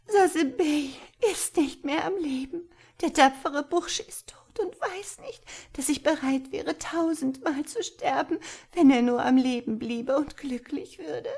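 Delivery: high-pitched, breathy lament; bitter weeping. A narrator's reading. Girl mourning the boy she believes dead, bitter high lament. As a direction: lament him in a high, grief-stricken girl's voice. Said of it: "weinte bitterlich".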